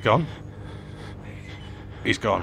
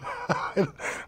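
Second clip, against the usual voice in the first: breathy high laugh; 10 semitones higher, 5 dB quieter than usual. Real, not acted. Laughing at own joke.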